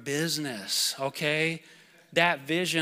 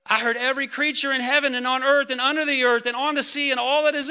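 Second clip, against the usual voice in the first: real excitement, high and loud, building to a whoop; excited preaching; 10 semitones higher, 8 dB louder than usual. Real, not acted. Preacher carried away by heaven's worship of Jesus, excited. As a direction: get carried away proclaiming it, at full force. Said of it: "I got a little excited there".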